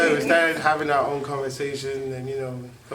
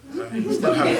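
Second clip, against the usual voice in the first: laughing through it; 14 semitones higher, 7 dB louder than usual. Retold or imitated. Laughing while retelling his reply.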